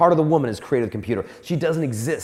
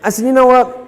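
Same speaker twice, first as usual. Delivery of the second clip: louder and higher, frantic self-talk; loud mock panic; 8 semitones higher, 8 dB louder, faster than usual. Pitcher panicking on the mound, loud and frantic. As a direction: blurt it out like a cornered pitcher.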